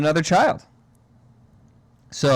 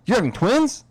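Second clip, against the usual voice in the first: surprised brother; 11 semitones higher, 5 dB louder than usual. Retold or imitated. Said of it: "He goes".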